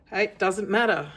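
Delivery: ordinary delivery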